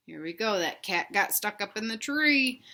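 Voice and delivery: ordinary delivery, nothing marked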